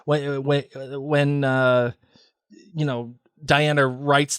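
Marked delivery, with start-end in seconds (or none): none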